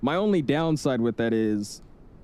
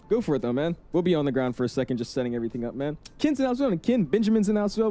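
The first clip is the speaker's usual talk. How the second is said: usual speaking voice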